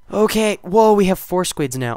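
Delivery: loud high surprised gasp; loud delighted surprise; surprised whoa. Real, not acted. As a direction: react to the squids. Surprised by squids.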